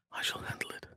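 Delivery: hushed stealthy whisper; sneaking whisper. Acted. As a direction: breathe it so no one hears. Stealth line in game.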